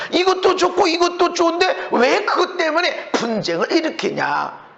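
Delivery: ordinary delivery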